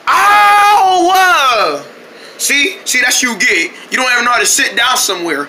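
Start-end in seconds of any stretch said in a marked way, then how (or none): 0.1-1.1 high, loud yelp of pain. Acted. Trying to sit down, he gets hurt and yelps. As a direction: yelp as you fall.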